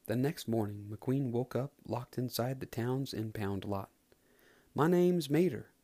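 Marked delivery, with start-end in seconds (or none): 4.7-5.7 higher, louder friendly country voice; friendly tow truck. Acted. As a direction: greet them like a cheerful country tow truck. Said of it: "a friendly tow truck said".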